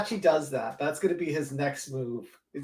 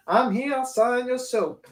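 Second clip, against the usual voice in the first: mock voice; 10 semitones higher than usual. Mocking the guy signing soap.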